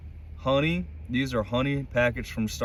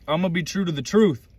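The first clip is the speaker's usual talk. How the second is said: higher, louder, building with conviction; impassioned exhortation; 7 semitones higher, 4 dB louder than usual. Real, not acted. Vlogger vowing to stay true to God, impassioned exhortation. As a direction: preach it like a motivational climax. Defiant motivational climax to viewers.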